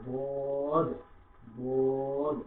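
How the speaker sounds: দোয়াত with a thick, struck d, drawn out; heavy dad. Shown as a demonstration. Teacher models দোয়াত for learners, thick and held long. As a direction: hit a heavy d and stretch দোয়াত. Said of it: "মোটা দোয়াত দিয়ে আমরা বলবো".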